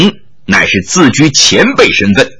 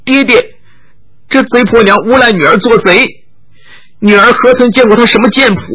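high whiny tearful complaint, hurried in places; tearful daughter; 7 semitones higher than usual. Acted. Daughter tearfully complains to her father about the swordswoman, high. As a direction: whine and sob like a wronged girl. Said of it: "那少女哭道".

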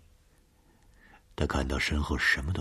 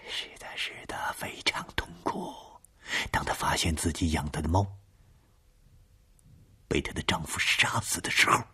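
eerie whisper. Acted. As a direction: ghostly voice whispering on the phone. Caller whispering eerie story.

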